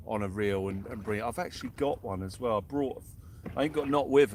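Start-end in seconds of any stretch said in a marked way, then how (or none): none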